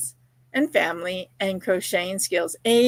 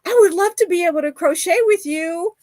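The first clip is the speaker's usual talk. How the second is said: put-on touched girl; 10 semitones higher, 5 dB louder than usual. Touched girl asks to crochet.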